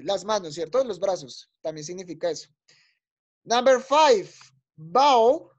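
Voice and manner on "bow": "bow" drawn out as one gliding syllable; stretched "bow" for the class to copy; pronunciation model. Shown as a demonstration. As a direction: model "bow" carefully for learners. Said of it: "Las voy a pronunciar yo".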